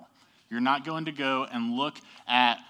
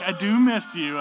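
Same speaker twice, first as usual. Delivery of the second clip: retold protest; 4 semitones higher, 7 dB louder than usual. Husband's retort in retold quarrel.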